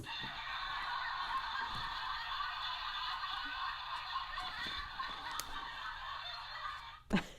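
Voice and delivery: long high silent wheeze of laughter; helpless breathless giggle; high wheezing laugh. Real, not acted. Laughing at Noreen's joke.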